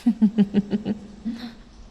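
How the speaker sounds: long rippling sinister chuckle; predator's amused laugh; amused villain's chuckle. Acted. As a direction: chuckle at the prey's bad luck. Amused by captive's tasty irony.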